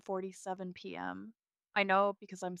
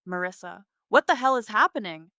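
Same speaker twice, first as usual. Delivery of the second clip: shrieking; 3 semitones higher, 12 dB louder than usual. Acted. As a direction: shriek it at her sister down the phone. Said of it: "she shrieks".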